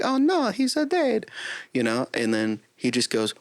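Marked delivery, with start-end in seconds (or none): 0.0-1.3 high cartoon Italian voice; mock dismay as the plumber; Mario impression. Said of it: "And he's like".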